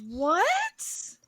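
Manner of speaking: high-pitched incredulous "what"; retold disbelief. Reacting to shoes washed in Coca-Cola, incredulous. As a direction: pitch shooting up in mock shock.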